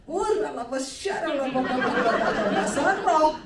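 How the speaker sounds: pastor's imagined angry tongues; mock angry preacher. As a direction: rattle off a pious tirade. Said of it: "kalau emosi mau marah".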